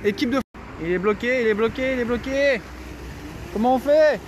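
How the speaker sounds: panicking while stuck; panicked. Real, not acted.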